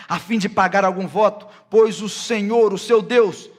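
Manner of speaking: loud, high, forceful verse reading; declaimed scripture. Acted. Preacher reads verse eighteen to the congregation, loud declamation. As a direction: proclaim the law to the whole congregation at full voice.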